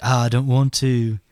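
a lazy [name] Jon; [name] Jon Snow. Retold or imitated. Retelling Jon refusing Tyrion, light Liverpool accent. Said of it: "That sounded like fucking [name] slash Jon Snow".

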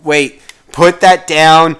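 high, slowed scolding parent; stern parent voice. Acted. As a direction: scold the kid like a harried parent. Parent scolding kid on phone.

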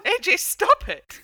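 high, loud, slow mock plea; overwhelmed cry. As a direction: beg the dancer to stop, overcome. Overwhelmed by dancer's move.

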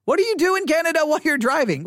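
baffled disbelief. Real, not acted. To Canadian listeners about driving: incredulous high question. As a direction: fire it off in amazed disbelief.

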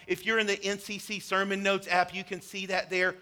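ordinary delivery

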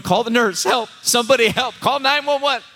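high, hammed-up cry for help; mock panic. Queasy dad in the waiting room calling for help, mock panic. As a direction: play a fainting man calling out, pitch climbing.

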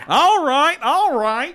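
high, loud McConaughey catchphrase; McConaughey drawl. Retold or imitated. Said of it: "Bring McConaughey in one more time".

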